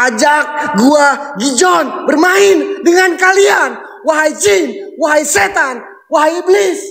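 high, drawn-out slow summoning cry; eerie summoning call. Acted. Ghost hunter at the empty house, calling out the spirits in a high, slow summoning wail. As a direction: call the spirits like a mock shaman.

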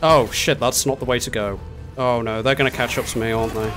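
ordinary delivery

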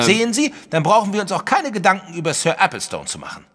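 very high, loud, emphatic retort; triumphant dismissal. Acted. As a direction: cut him off in triumph. Wilson triumphantly dismisses concern.

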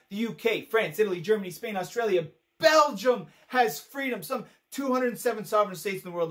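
loud, high, hammered-out list; exasperated shouting. Acted. Acted rant, hammering out countries.

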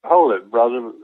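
ordinary delivery

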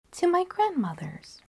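put-on high, innocent little girl; girl's reply. Girl telling the wolf where she goes, high.

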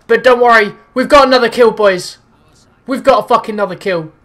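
high, loud celebration; excited shout. Real, not acted. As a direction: cheer the kill. Celebrating kill.

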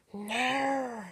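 drawn-out put-on cry in an odd voice; high-pitched purge guy. Purge villain to the players, high-pitched put-on voice. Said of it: "So it's a high-pitched guy".

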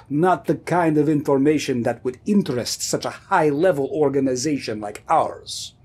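hammed-up Russian accent; clumsy mock-Russian voice; bad Russian accent. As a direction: play a KGB agent in a poor Russian accent. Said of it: "how to do a good Russian accent".

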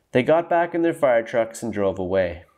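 usual speaking voice

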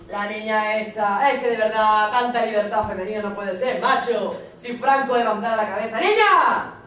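gossiping policeman. Retold or imitated. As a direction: call out to a colleague, scandalised. Policeman's scornful remark continues.

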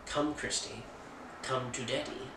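lightly voiced creepy coaxing, kept soft; creepy line quote. Host quoting the film's line to the daughter, softly creepy. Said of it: "It would sound a lot more creepier that way".

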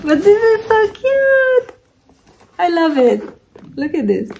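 high-pitched squeal of delight; gushing adoration. Real, not acted. Gushing over a cute bag.

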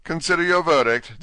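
high, loud royal command; a pompous little king barking orders at the jury; fussy king calling court to order. Acted.

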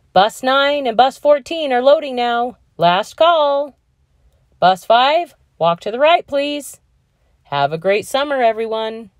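calm announcer over a loudspeaker; PA announcement. Acted. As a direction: read it like the school PA. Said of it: "Mister Frazier's calm voice was announcing".

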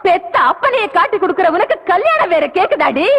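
usual speaking voice

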